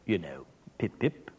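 mock British accent, dropping low and quiet. Shown as a demonstration. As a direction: play at being British, sinking into a low murmur. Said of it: "If I was British".

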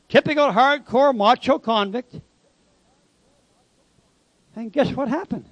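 normal talking voice

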